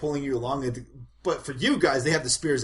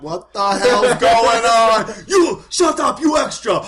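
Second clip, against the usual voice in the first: slow then shouted, much higher and louder; Malone's outburst; 8 semitones higher, 13 dB louder than usual. Acted. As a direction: blow up in a hammed-up rage. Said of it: "Malone's like".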